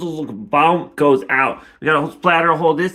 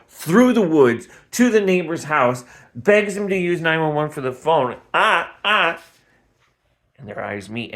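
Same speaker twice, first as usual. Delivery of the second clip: ordinary talk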